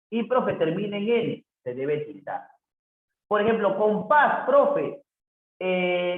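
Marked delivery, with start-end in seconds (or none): 3.8-4.4 "compás" with a loud, high "pás"; stress on last syllable. Shown as a demonstration. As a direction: punch the final "pás" of "compás". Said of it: "la sílaba con mayor fuerza de voz es paz".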